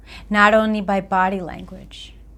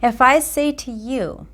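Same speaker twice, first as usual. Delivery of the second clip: normal talking voice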